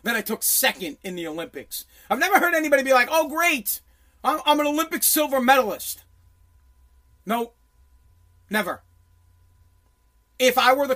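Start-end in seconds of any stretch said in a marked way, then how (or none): 3.1-6.0 higher-pitched sarcastic cheer; mock delight. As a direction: mockingly celebrate coming second. Mocks an imagined happy silver medalist.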